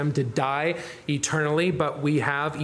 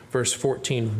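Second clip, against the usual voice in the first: normal talking voice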